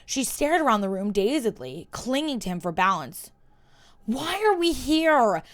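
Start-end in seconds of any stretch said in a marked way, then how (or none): 4.1-5.4 slow, higher, a touch shaky demand. Acted. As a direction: insist on an answer though unsteady. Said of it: "she demanded".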